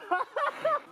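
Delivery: short burst of high laughing; high laughter. Real, not acted. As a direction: crack up suddenly. Laughing mid-sentence.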